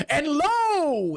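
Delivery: high, drawn-out sermon cry; ringing pulpit call; preaching priest. Acted. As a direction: proclaim it to the whole congregation. Priest booms out mid-sermon.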